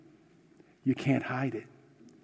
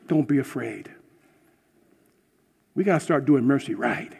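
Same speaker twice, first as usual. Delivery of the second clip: usual speaking voice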